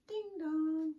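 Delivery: high, loud sing-song doorbell; doorbell ding dong. Shown as a demonstration. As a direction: chime it like a doorbell. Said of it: "can you say ding dong again?".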